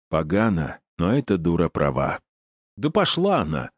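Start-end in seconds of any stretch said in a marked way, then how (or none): 2.8-3.7 high sharp angry retort; angry snap. A narrator's reading. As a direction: spit it out in fury. He snaps angrily.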